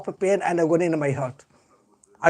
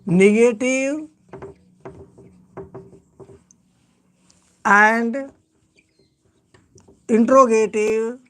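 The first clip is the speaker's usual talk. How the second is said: slow dictation with long pauses between phrases; 2 semitones higher, slower than usual. Shown as a demonstration. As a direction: dictate word by word, leaving time to write. Dictates note to the class.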